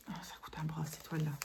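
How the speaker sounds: soft conspiratorial whisper about the price; whispered aside. Real, not acted. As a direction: whisper it like a guilty secret.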